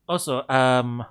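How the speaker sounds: normal talking voice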